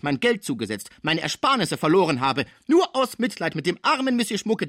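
high-pitched, aggrieved tirade; indignant scolding. Acted. Cibot scolds Pons for leaving his bed, indignant. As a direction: berate the patient as the injured party. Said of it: "stemmte sie die Arme in die Hüften und sagte".

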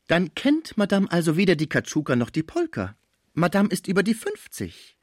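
high, teasing dancer's voice; dancer, mocking. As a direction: needle your rival as the dancer. (spöttische Tänzerin, hohe Stimme)

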